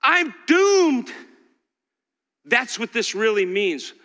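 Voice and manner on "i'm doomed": loud, high cry of doom; despairing cry. Retold or imitated. Pastor voicing Isaiah's despair before God. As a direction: wail it as a ruined man. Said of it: "He said".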